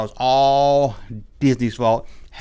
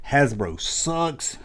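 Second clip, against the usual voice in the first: slow deadpan; 4 dB quieter, much slower than usual. Shown as a demonstration. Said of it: "I guess I'm not getting any free toys".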